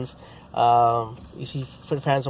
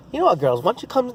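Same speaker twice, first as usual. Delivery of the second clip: higher, lightly done Mike Tyson voice; light Tyson impression; Tyson imitation; 8 semitones higher, faster than usual. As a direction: invite the girls over like Tyson would. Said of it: "I did kinda like on Tyson kinda imitation".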